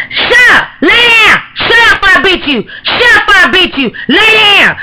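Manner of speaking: put-on little girl yelling threats in play; bossy little girl. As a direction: boss the other kids like a mean granny. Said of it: "little girl's like".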